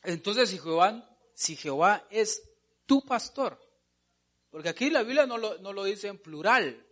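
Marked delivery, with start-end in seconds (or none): none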